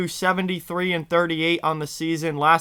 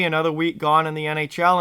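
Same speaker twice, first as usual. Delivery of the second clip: ordinary talk, nothing marked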